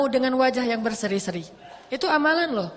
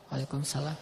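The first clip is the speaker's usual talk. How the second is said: reply so softly it can barely be heard; very soft, low-voiced return greeting; hushed reply; 9 semitones lower, 10 dB quieter than usual. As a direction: answer the greeting under your breath on purpose. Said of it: "Jangan dijawab jelas-jelas".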